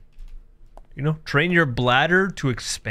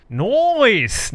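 "Noise!" called out higher and louder, falling; mock exclaim; 8 semitones higher, 3 dB louder than usual. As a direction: blurt "Noise!" like an alarm. Repeats word two ways.

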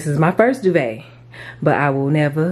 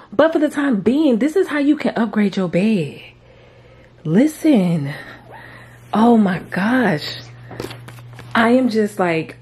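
excited; 6 semitones higher, 4 dB louder than usual. Real, not acted. Thrilled at the finished bed.

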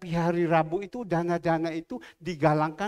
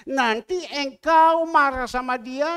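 slow, loud, raised warning; slow stern warning; 7 semitones higher, 10 dB louder, slower than usual. Retold or imitated. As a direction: lay down the warning slowly and loudly. God warns, slow and loud. (peringatan tegas, pelan dan keras)

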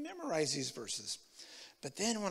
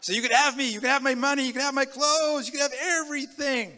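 loud, high, ringing offer of everything; fervent surrender; 8 semitones higher, 9 dB louder than usual. Retold or imitated. New believer crying out his surrender to God, loud and fervent. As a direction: pour it all out, giving himself to God.

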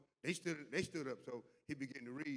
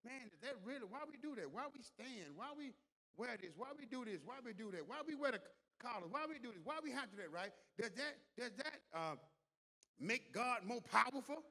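high-pitched string of mock questions; imitated questioning; 8 semitones higher than usual. Voices people questioning church customs.

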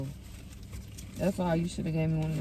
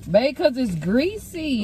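raised, drawn-out complaint; higher, louder and slow, really complaining; yelling; 6 semitones higher, 5 dB louder, slower than usual. Real, not acted. Girlfriend complaining to partner about greasy food, yelling. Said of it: "why you yelling at me".

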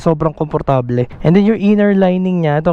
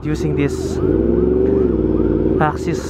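talking while riding a motorcycle; muffled through full-face helmet; speaking inside a helmet; 4 dB quieter than usual. Real, not acted. Seen 3: Rider wears closed full-face helmet, riding in traffic throughout.

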